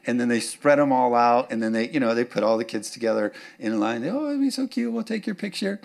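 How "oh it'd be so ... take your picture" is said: high, cooing proud parent; mock gushing parent. Pastor mimics relatives gushing over cute kids. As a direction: coo like a doting parent. Parents voiced at egg hunt.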